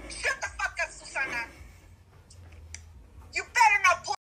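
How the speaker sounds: ordinary delivery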